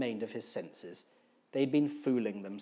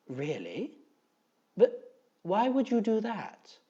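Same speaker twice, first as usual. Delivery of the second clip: genuinely confused; 7 semitones higher, 3 dB louder, slower than usual. Acted. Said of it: "he asked, genuinely confused".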